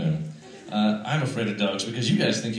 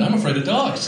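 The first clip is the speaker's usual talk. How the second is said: higher, insistent declaration; emphatic admission; 8 semitones higher than usual. Pretend. Comic repeating his fear to the crowd, emphatic.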